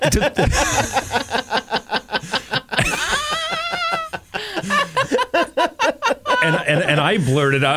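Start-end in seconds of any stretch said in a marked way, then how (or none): 0.4-6.4 long, high, wheezing belly laugh; helpless laughing fit. Real, not acted. Storyteller cracks up at his own punchline, helpless laughter. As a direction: laugh until you can barely breathe.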